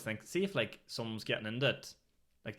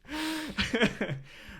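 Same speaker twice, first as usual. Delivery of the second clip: squealing laugh up high; real laugh, high and falling; high burst of laughter; 4 dB louder than usual. Real, not acted. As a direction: crack up at the memory. Laughing at own story.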